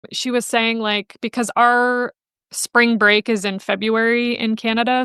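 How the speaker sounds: ordinary talk, nothing marked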